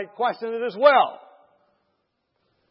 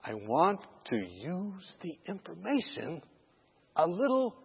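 whispering; 9 dB quieter than usual. Retold or imitated. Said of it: "whispering to each other again".

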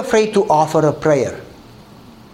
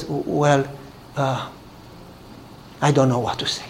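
soft, low, halting reply; understated tearfulness; 5 semitones lower, 8 dB quieter than usual. Retold or imitated. Neighbor overwhelmed by a gift, understated tearful hesitation. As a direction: falter quietly as if lost for words. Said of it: "she starts crying".

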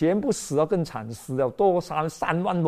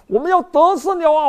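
higher, louder cheer of victory; excited cheer; 12 semitones higher, 8 dB louder than usual. Acted. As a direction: Israelites shouting they will win. Israelites cheering the ark. (兴奋地高喊)

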